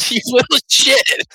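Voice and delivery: high, slow mock grumble; a hint of playful indignation; mock annoyed friend. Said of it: "Tyler's like".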